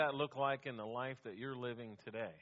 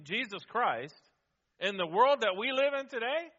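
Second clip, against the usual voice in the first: rising, louder preacher; preaching; 7 semitones higher, 9 dB louder than usual. Acted. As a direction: build the sermon to a peak. Preaching climax.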